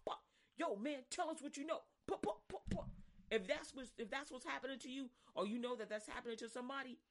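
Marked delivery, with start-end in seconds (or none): 2.1-2.9 mock punch sounds. Imitating hits, higher.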